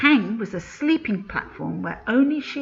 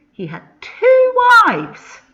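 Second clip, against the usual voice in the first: much higher and louder, slow astonished gasp; shocked exclaim; 14 semitones higher, 13 dB louder than usual. A narrator's reading. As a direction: announce it like a shocking secret. Narrator gasps at a plot twist.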